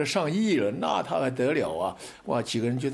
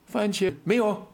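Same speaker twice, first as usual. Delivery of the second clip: curt clerk; 8 semitones higher than usual. Retold or imitated. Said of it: "那么在大陆的问他".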